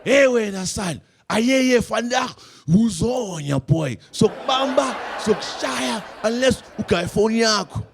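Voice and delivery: loud, high-pitched mock tirade; hammed-up robber's angry shouting; cussing out. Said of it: "They start cussing me out".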